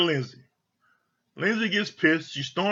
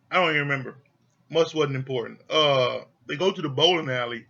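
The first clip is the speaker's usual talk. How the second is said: ordinary delivery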